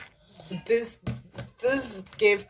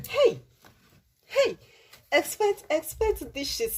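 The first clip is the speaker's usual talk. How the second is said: higher and louder calls of expect; excited promise; 8 semitones higher, 3 dB louder than usual. Real, not acted. Vlogger excitedly tells viewers to expect dishes. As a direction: hype the viewers up.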